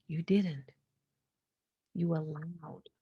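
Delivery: whispered comfort to her past self; hushed reassurance. Retold or imitated. Telling her younger self she did nothing wrong, whispered.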